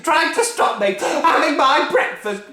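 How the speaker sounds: bully the whole family; furious Grandma. Acted. Grandma demanding her breakfast, loud bullying bellow.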